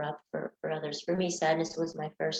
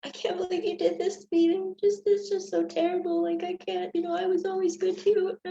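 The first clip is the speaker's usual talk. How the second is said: high, whiny, wounded voice; self-pitying whine, much higher; sad way; 12 semitones higher than usual. Shown as a demonstration. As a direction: play the hurt victim, pitched up. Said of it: "could be more in a sad way".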